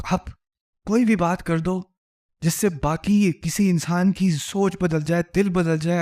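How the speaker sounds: normal talking voice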